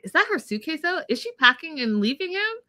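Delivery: high, eager hopeful question; retold excited thought, pitched high. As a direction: gasp it out like you just spotted hope. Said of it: "excited for a minute 'cause I was like".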